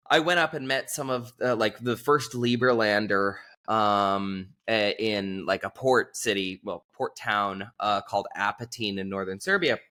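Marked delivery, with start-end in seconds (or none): none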